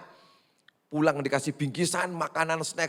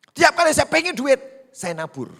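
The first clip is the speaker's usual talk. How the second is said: much higher, louder burst; preaching shout; 11 dB louder than usual. Real, not acted. Pastor preaching to the church, shouting with fervor. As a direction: fling it out at full force.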